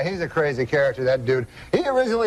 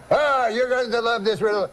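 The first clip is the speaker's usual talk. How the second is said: put-on higher-pitched comic French-Canadian accent; Jean-Luc's French-Canadian accent; 6 semitones higher than usual. Uncle answers the cab driver, higher French-Canadian accent. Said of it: "That's how he talks".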